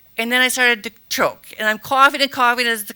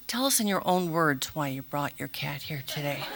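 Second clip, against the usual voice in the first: mock calm; 8 semitones lower, 11 dB quieter than usual. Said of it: "and they're like".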